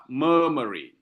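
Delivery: "murmuring" with a weighted first syllable, two light ones after; stress on first syllable. Shown as a demonstration. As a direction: lean on the "mur" in "murmuring". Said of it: "stress syllable followed by two that are not stressed".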